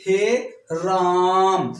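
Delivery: हे Ram stretched very slowly, vowels held; खींचकर हे Ram; drawn-out vowel. Shown as a demonstration. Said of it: "थोड़ा बढ़ा के खींच के बोलो".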